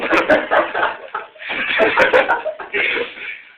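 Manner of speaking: high, loud laughing fit; long real laugh, high and loud; loud laughter. Real, not acted. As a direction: burst out cackling. Laughs after chainsaw line.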